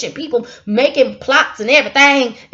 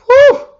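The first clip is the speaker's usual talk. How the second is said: startled whew; 13 semitones higher, 9 dB louder than usual. Real, not acted. Catching herself after cursing.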